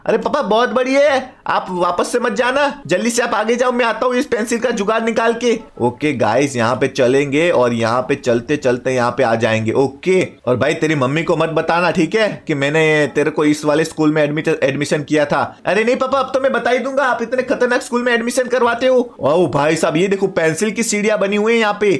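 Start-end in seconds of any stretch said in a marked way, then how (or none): 0.0-5.6 higher-pitched son talking to his papa; son's high, chatty voice; eager son. Acted. 15.6-19.1 higher-pitched son scolding his papa; son's high voice, mildly indignant; complaining son. Acted.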